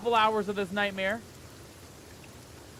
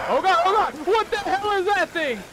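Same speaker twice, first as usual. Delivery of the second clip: loud, high burst of shock; terrified shouting; 11 semitones higher, 8 dB louder than usual. Real, not acted. Player spotting an unknown thing, terrified shout. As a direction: scream it out in sudden fright. Creature appears suddenly.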